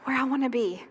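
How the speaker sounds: normal talking voice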